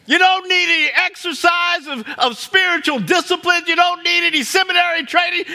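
loud and high, full preaching force; shouted sermon climax. Real, not acted. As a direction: proclaim it to the back row. Preacher shouting about Jesus.

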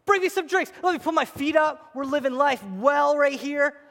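high, loud spoiled demanding voice; mock pampered wife. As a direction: play the spoiled wife ordering drinks. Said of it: "you say to your husband".

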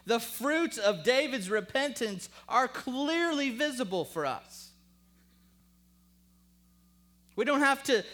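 loud, high, emphatic sermon; full-force preaching. Real, not acted. Preacher answering his own questions to the congregation, forceful. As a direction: drive each answer home to the crowd.

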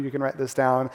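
usual speaking voice

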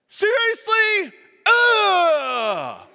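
hammed-up childish Ugh, loud and long; whiny kid. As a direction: mimic a child appalled by dinner. Kid complaining about dinner.

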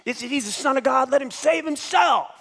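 mock him like a jeering bystander; sneering mockery. Crowd jeering at Jesus on the cross, high sneering shout. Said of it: "disrespectful, you know".

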